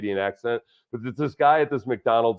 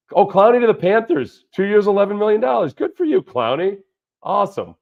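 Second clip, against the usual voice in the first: higher, louder burst at signing news; excited; 8 semitones higher, 5 dB louder than usual. Real, not acted. Host reacting to Clowney signing news, excited. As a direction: cheer the signing like a fan.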